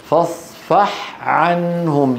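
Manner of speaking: فاصفح عنهم slow, ح distinct before ع; clearly separated Ha. Shown as a demonstration. Teacher showing students how to keep ح clear beside ع. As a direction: finish the breathy ح of فاصفح before starting عنهم. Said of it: "عدم بيانها".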